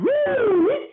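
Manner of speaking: high, drawn-out swooping "woo"; soaring woo. Retold or imitated. Speaker imitating a plane at full flight, soaring woo. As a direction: whoop like a plane zooming upward.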